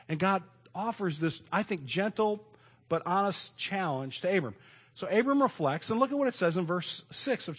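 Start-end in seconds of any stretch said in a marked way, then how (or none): none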